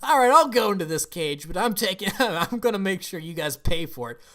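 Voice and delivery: high, loud, half-laughing mock defiance; feisty dragon's threat. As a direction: play a dragon agreeing but plotting payback. Voices the dragon's imagined grudging threat.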